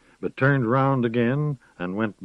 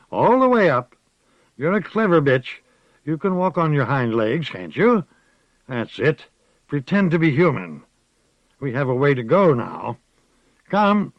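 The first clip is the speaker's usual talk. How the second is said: loud, higher sneering man's voice; cruel mocking captor; 5 semitones higher, 4 dB louder than usual. A narrator's reading. Captor mocks the leashed woman as a dog, loud sneering voice. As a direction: taunt her like a dog.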